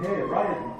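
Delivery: badly faked Vietnamese accent. Vietnamese wife's aunt calling to him, bad Vietnamese accent. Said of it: "this is bad Vietnamese accent".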